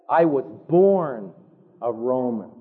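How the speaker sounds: low, slow and calm. Retold or imitated. Paul facing the commander, low slow calm declaration. Paul answers, looking him in eye.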